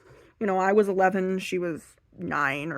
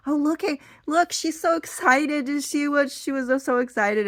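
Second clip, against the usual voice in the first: higher-pitched mock delight; imitated excited gushing; 8 semitones higher, 4 dB louder than usual. Aunt delighting in the gift reaction, excited gushing. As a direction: gush like a thrilled onlooker.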